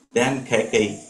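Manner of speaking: plain reading voice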